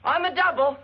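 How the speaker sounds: high loud call back; yelling across the room. Acted. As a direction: shout it down the stairs. Calling back from afar.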